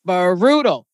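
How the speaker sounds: "brutal" broken out as buh-ROO-tal; "brutal" stretched for heavy emphasis; emphatic BRUTAL. Real, not acted. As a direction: draw out the opening of "brutal" and hit ROO. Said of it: "brutal with a capital B".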